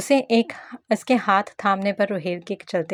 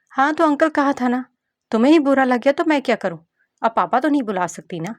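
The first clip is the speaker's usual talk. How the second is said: put-on a cheeky child snapping back, pitched higher; bratty little girl; 6 semitones higher, 5 dB louder than usual. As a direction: voice a spoilt kid scolding a grown-up. Narrator voices the little girl. (गुस्सैल बच्ची की तीखी आवाज़)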